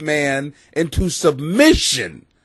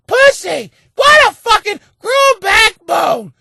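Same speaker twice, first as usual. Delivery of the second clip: high, slow mock shout in a woman's voice; 7 dB louder, slower than usual. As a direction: screech it as a scornful caricature. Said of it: "the first thing you do is go".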